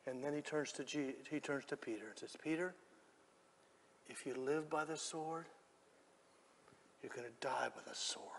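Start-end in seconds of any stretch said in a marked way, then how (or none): 2.4-8.3 hushed, spaced-out gentle warning; very soft, falling away at the end; quiet correction. Acted. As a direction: softly correct a friend, pausing between phrases. Jesus gently correcting Peter.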